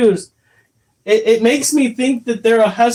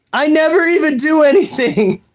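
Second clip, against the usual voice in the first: a higher, put-on teen whine; mock whiny kid; 8 semitones higher than usual. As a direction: playfully voice the kid protesting innocence. Mocking the fifteen-year-old.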